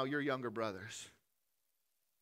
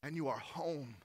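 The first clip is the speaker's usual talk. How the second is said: loving father speaking; 4 semitones higher, 7 dB louder than usual. Acted. Father's words voiced in sermon.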